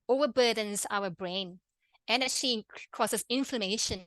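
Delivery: ordinary delivery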